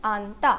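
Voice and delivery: आंता split as low आन, then ता; pronunciation demo. Shown as a demonstration. Teacher answers how the word is pronounced, sounding out आंता by syllable. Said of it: "इस word का pronunciation क्या हुआ".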